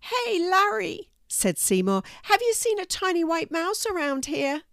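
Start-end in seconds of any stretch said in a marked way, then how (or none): 0.0-1.1 high, slow drawn-out call; high-pitched cartoon cat; friendly cat character. A narrator's reading. Narrator voices the cat Seymour. 2.2-4.6 high, curious cat voice; high-pitched inquiring cat; friendly cat character. A narrator's reading. Narrator voices the cat Seymour.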